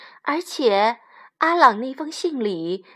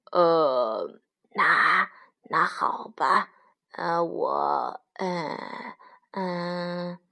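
low, faltering wolf stammer; low hesitant mumbling with drawn-out fillers; lost for words; 5 semitones lower, 4 dB quieter, much slower than usual. A narrator's reading. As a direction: hem and haw, unable to explain. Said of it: "什么也说不出来".

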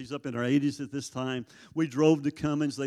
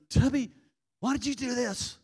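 called out much higher and louder, then pleading; calling out; 10 semitones higher, 3 dB louder than usual. Retold or imitated. Preacher calling to inmate through window, loud and pleading. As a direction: call his name through the window, then ask.

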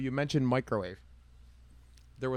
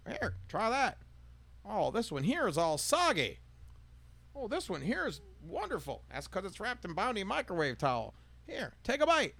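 high, drawn-out ad-lady voice; Rosie the waitress; 9 semitones higher than usual. Retold or imitated. Rosie offering the customer a bite, high and sugary. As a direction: coax him to taste it, sweetly overdone. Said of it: "she cooks 'em both".

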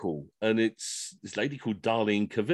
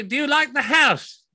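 overenunciated question to the deaf; slow, loud and high; 14 semitones higher, 4 dB louder than usual. Retold or imitated. Host speaks to deaf guests, slow, loud and high. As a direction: shout slowly so the deaf can hear. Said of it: "And you say, like".

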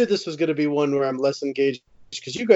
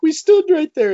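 bursting out high and louder; excited; 14 semitones higher, 6 dB louder than usual. Real, not acted. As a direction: burst out with delight. Excited about the shared spot.

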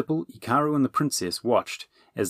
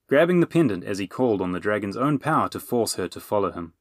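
usual speaking voice

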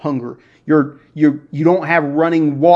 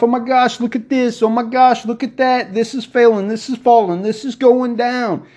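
higher, lightly hammed-up fretting; mock panic; 6 semitones higher than usual. Host imitating people hooked on fear, mild mock panic. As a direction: play a fretful worrier watching things fall.

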